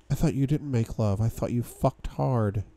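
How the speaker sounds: husky woman's voice, slowing at the end; husky, seductive. A narrator's reading. Woman questions the man about making love, husky and seductive. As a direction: put on a seductive, husky voice for her. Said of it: "her voice husky and so damn seductive".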